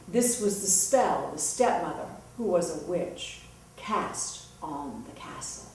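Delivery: ordinary delivery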